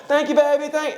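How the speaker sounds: high-pitched, mock-weepy thank-you; imitating tearful thanks. Man thanking strangers who helped him cross the street, imitated tearful voice. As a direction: thank them as if starting to cry. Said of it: "I start crying".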